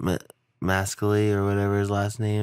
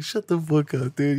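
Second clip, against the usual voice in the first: higher, mock-annoyed retort; 7 semitones higher than usual. A buddy telling the dad to stop teasing, mock retort. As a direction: brush off the teasing.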